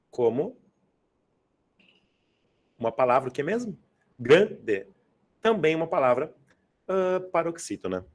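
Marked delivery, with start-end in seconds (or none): 4.2-4.9 "Grande" as GRAN, short pause, de; stressed syllable. Shown as a demonstration. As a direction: punch the first syllable of "Grande" and drop on de. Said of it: "quanto à sílaba tônica".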